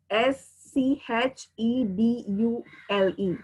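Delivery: ordinary talk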